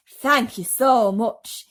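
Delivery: slow, loud, lightly voiced centipede; a grand, drawn-out thank you; slow showy bug voice. Centipede character voice.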